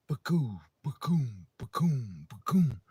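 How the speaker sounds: repeated falling imitated gunshot booms; a string of playful ba-coom gun noises; shotgun blasts. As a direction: mimic each shotgun blast with your mouth.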